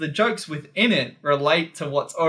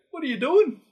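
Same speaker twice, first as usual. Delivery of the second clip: high, bemused question; quoting a Limmy sketch; 13 semitones higher than usual. Retold or imitated. Quoting a comedy sketch line, high and puzzled. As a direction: ask it as the baffled sketch character. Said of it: "That, that joke".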